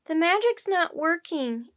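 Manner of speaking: high, loud, slow lament; crying out. A narrator's reading. Annie tells Jack their effort fails, crying out. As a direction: call out in dismay. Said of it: "Annie cried to Jack".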